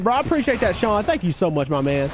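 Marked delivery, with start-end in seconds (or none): none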